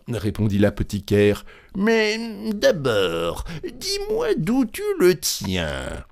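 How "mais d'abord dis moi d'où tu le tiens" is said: very high, slow, wary figure; apothecary voice. A narrator's reading. Apothecary asking Grand Claus about the corpse, high wary voice. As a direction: question slowly in a high put-on voice.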